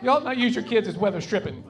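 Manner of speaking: ordinary delivery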